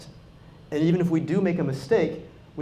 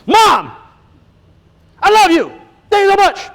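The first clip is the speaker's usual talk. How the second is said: high, loud, effusive gush of thanks; gushing daughter; 13 semitones higher, 12 dB louder than usual. Retold or imitated. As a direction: imitate a girl swooning over her mom, much higher and louder. Girl gushing at mom in commercial.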